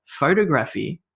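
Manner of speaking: Photography as PHO-to-graphy, rising; puzzled echo of Photography stressed on PHO; Photography wrongly stressed on the first syllable; wrong stress, questioning. Shown as a demonstration. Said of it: "stress on the wrong sound".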